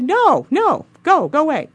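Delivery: high, loud retold brush-off; emphatic rejection. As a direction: shoo the suitor away, playfully acting it out.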